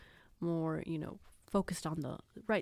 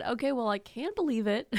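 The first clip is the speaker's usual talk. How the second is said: higher, louder teasing quip; mock cheeky reply; 5 semitones higher, 5 dB louder than usual. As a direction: tease the client as a joke. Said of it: "No, I didn't say that".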